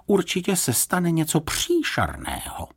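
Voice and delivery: high, mildly wailing worry; lamenting. A narrator's reading. Lady expecting disaster from the trick, high mild lament. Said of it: "lamentovala paní Ptáčková".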